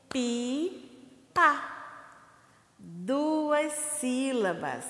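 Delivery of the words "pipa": Pipa split into two slow clapped beats; syllable by syllable. Shown as a demonstration. Teacher counting syllables with claps. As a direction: clap out Pi-pa slowly. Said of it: "Vamos contar novamente, ó".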